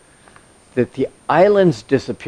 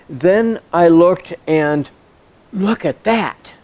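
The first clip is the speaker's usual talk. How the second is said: higher, drawn-out astonishment; emphatic surprise; 5 semitones higher, slower than usual. Acted. John catching sight of the Lamb on Mount Zion, surprised. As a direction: stop short, then stretch out the amazed reaction. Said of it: "this really caught him by surprise".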